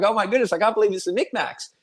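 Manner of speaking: high-pitched starstruck gushing; fans freaking out. Retold or imitated. Storyteller imitating fans spotting celebrities in the street, freaking out. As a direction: imitate an overwhelmed fan, voice lifted. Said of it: "start crying and freak out".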